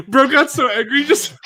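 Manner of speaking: high voice through laughter; laughing hard mid-sentence. Real, not acted. Laughing at friend's mic mishap.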